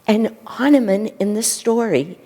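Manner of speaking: ordinary delivery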